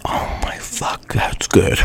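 breathy amazed whisper. Real, not acted. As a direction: whisper it, overwhelmed by the taste. Reacting to the taste while eating.

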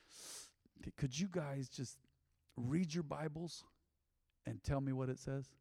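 soft, slow, low-key plea; hushed request. Pretend. Speaker to his close inner circle, hushed and slow. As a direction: ask it quietly, drawn out.

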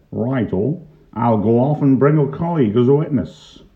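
loud, high announcement; officious policeman. A narrator's reading. Pongo goes for a colleague as witness, loud and officious. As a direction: declare it loudly, as the law.

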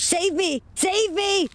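high, frightened shriek; begging for rescue in high alarm; panicked cry for help. Acted. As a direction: plead shrilly, terrified. Lydia panics, creature loose.

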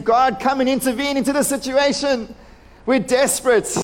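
high-pitched, urgent plea to God; impassioned prayer of desperation; desperate crying out. Acted. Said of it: "he's crying out to God".

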